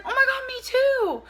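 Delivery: high, bright mock-girly gush; excited girly voice. New friend also loves Catfish, gushing high and excited. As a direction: gush like a teen fan.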